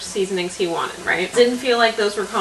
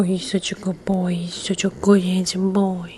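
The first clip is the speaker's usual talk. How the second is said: whisper to the cat. Real, not acted. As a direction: hush it to the cat.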